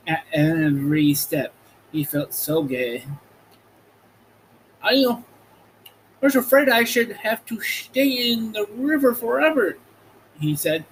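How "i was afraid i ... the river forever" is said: much higher, relieved straw man; a grateful Scarecrow, voice pitched up; Scarecrow's voice. A narrator's reading. Scarecrow telling friends he feared the river, high voice.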